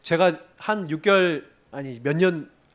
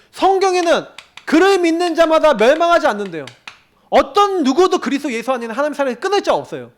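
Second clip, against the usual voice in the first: high, loud preaching at full force; fervent preaching; 9 semitones higher, 6 dB louder than usual. Acted. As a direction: preach it out to the congregation. (높고 크게 열정적으로 설교)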